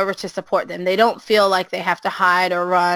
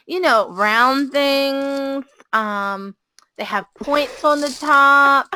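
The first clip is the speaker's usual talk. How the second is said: put-on coy child voice; 5 semitones higher, much slower than usual. Girl hinting at scissors, playing games.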